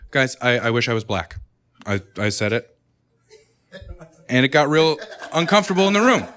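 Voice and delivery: normal talking voice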